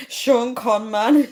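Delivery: real laughing. Real, not acted. Streamer reading a joke about [name], laughing. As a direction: draw out the joke name, laughing.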